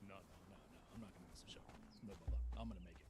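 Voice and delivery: low, hurried brush-off; low, careless mock reassurance; imitating dismissive friend. Voices friend dismissing the warning.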